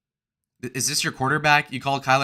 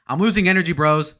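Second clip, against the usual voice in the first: raised, higher-pitched announcement; loud complaint; 5 semitones higher, 7 dB louder than usual. Real, not acted. Streamer telling viewers he is losing energy, loudly. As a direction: call it out to the bros.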